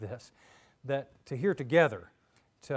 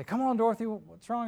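high, urgent calling; worried friends; 8 semitones higher, 4 dB louder than usual. Acted. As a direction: call to her, worried. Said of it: "they say".

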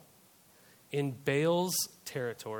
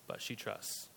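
hushed whisper; 6 semitones lower, 14 dB quieter than usual. Real, not acted. Preacher whispers the widow's trust to his congregation. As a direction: drop to a breathy whisper.

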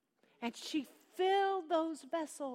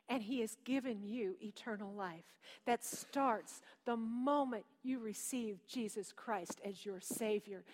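normal talking voice

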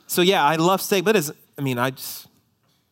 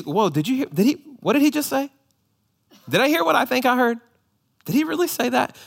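high, fast, astonished disbelief; as if jolted awake; startled listener; 11 semitones higher, faster than usual. Pretend. Dozing listener jolted awake by Jesus, high and astonished. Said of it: "it would make us go".

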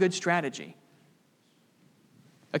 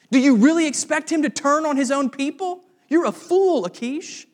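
higher, louder mock scorn; scornful commanders; 6 semitones higher, 4 dB louder than usual. Commanders mocking Achish for trusting David, scornful. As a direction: sneer at the foolish king. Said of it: "That's what the commanders say".

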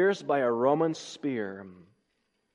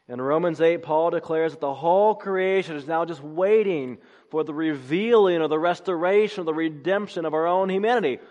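louder, higher preaching at full force; rising sermon climax; 6 semitones higher, 5 dB louder than usual. Acted. Preacher proclaiming the coming redemption, impassioned. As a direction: build toward the hope of resurrection.

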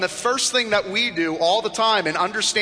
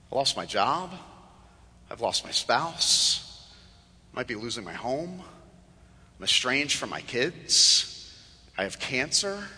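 low, subdued, halting confession; soft voice of someone hurting; understated sorrow; 6 semitones lower, 6 dB quieter than usual. Acted. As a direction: quietly list each loss, pausing between. Voicing hurting churchgoers listing their losses.

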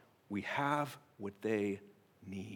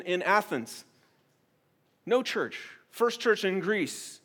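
normal talking voice

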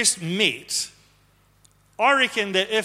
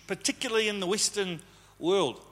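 ordinary talk, nothing marked

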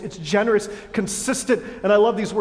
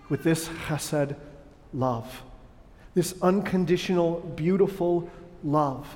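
much softer, lower, gentle reassurance; tender assurance; 4 semitones lower, 5 dB quieter than usual. Real, not acted. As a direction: tell each listener they are loved, gently. Pastor tenderly assures congregation.

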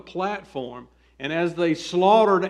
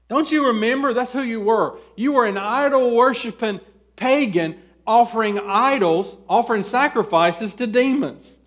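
insistent, higher-pitched preaching; urgent reminder; 6 semitones higher, 4 dB louder than usual. Acted. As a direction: press the reminder home to a forgetful flock. Preacher voices apostle's urgent reminder.

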